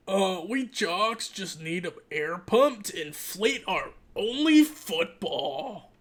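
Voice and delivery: slow, dopey jock character. Acted. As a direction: drag it out like a dim athlete. Voices jocks in the story.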